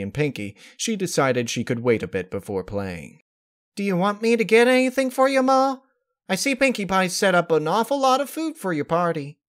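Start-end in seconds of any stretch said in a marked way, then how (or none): 3.8-9.4 high, quick eager daughter voice; high youthful voice, quick and earnest; young daughter. Acted. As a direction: fuss helpfully over mom. Daughter voiced offering help.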